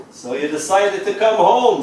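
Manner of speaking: a touch of a sneer. Acted. Passing breeze to the returning poet, mild sneer. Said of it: "sneered a passing breeze".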